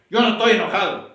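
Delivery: loud, defensive mock denial that he's angry; mock angry protest. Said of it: "por qué me hablas con tanto gozo en tu voz".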